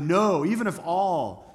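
NO hit hard and high, then slow, weighty words; emphatic protest. Acted. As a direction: argue back, leaning hard on No. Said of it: "argues and says emphatically".

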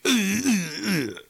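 high put-on grunts of disgust; mock disgust grunts. Jellyfish swiping past him, high disgusted grunts. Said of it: "And you just like".

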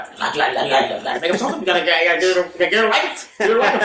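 hammed-up boss pleading to make a sale; frantic boss. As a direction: rant like a wired manager.

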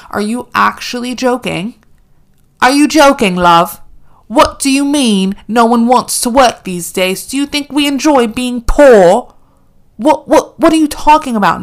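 loud, high, incredulous ranting; slowing to hammer the lines, loud and high; outraged rant. Real, not acted. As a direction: let the outrage build and shout it. Ranting at Kim's advice.

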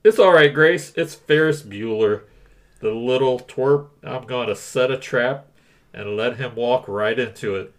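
a hint of a nasal principal; lightly voiced smug school principal. Reads principal Rooney role.

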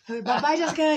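sing-song it to tease; mock farewell. Mocks Shawnee's wrong name.

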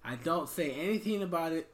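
bargaining tone. Retold or imitated. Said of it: "you should have been like".

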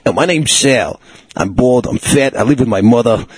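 lightly voiced New Jersey accountant; New Jersey accent for a sad-sack accountant. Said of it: "an accountant from New Jersey".

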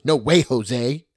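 put-on slow, drawn-out sassy little girl refusal; toddler refusal. A mom imitating her toddler refusing a kiss, slow and sassy. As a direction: drag it out like a stubborn three-year-old.

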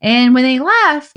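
ordinary talk

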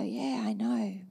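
low, offhand, already sure of it; casual knowing reply. Retold or imitated. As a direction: shrug it off calmly, not arrogant. Told she is called again.

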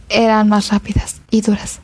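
plain reading voice

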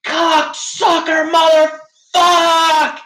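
drawn-out, high-pitched furious yell; retold scream. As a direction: scream the curse full out, stretching each word. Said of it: "I think I'm screaming".

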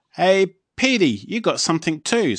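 high, loud calling guard. A narrator's reading. Guard handing Fluffy a letter, high and loud. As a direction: sing it out like a cheery guard. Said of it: "says the guard".

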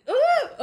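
high, loud hoot; imitated audience reaction; crowd "ooh". Classmates watching the fight, high loud "ooh".

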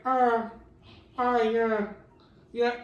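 imitating her loud high moans, yeah; mock moaning. Deaf partner moaning during sex, mock loud moans. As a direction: moan it out, loud and high, hammed-up. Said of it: "she's in there like".

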